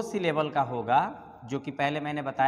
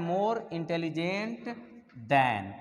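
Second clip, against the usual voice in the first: "more intelligent" said very slowly, stretched out; slow dictation; much slower than usual. Shown as a demonstration. Teacher dictating the comparative form very slowly. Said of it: "showing where than goes".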